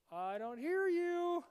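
high, drawn-out sing-song pretence of not hearing; mock refusal to listen. Preacher mimicking people who won't hear God, in mock refusal. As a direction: stretch it out high, playing someone deaf to advice.

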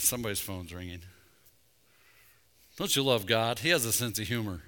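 ordinary delivery

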